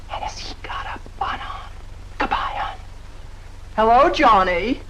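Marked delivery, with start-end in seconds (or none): 0.1-2.8 hushed aside as he walks in; breathy whisper, not meant to be overheard. Acted. Millie whispering to Kitty as Johnny arrives, hushed.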